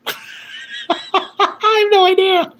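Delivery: laughing it off, voice squeaking high and slow. Real, not acted. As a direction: laugh through the words, totally puzzled. Laughing at a confusing chat message.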